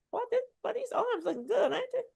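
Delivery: soft, high, teasing brag; self-admiring aside. Real, not acted. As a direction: coo over your own biceps. Admires her own arms on camera.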